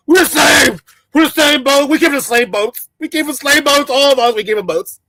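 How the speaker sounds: high, loud mocking cry of unity; mocking shout. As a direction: shout it like an eager convert. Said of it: "all of a sudden they don't want to delineate".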